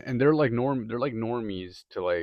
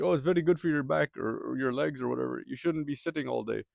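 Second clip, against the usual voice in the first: higher, lightly put-on Indian accent, preachy; Indian accent impression; 4 semitones higher than usual. Coworker explaining his standing desk, light Indian accent. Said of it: "Sorry if my accent's bad".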